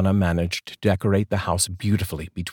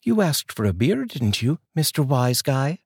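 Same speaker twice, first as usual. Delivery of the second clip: high, playful female voice; wife teasing her husband with a smile; smiling wife; 6 semitones higher than usual. Acted. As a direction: tease him with a grin. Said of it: "she replied with a smile".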